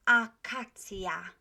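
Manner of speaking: "Akacja" slow, every A equally open; full Polish A's. Shown as a demonstration. Teacher modelling equal vowels, slow and full. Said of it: "pronouncing all of those A's".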